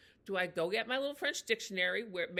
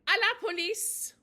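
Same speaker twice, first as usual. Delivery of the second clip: "À la police" yelled much higher and louder; shouting for the police, demonstrated; demo shout; 11 semitones higher, 9 dB louder than usual. Shown as a demonstration. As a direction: shout it out for help.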